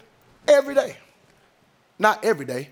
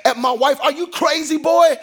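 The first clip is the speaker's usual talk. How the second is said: higher, louder furious-father yell at his son; retold angry dad; 5 semitones higher, 3 dB louder than usual. Father bursting in, yelling at his son for his tone. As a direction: tear into the boy like you just burst in.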